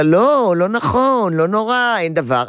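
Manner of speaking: high, breezy brush-off of the fear; dismissive brushing off. Shown as a demonstration. Mother brushing off her daughter's fear, dismissive. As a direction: wave the worry away lightly. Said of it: "אני לא מבטלת ואומרת לה".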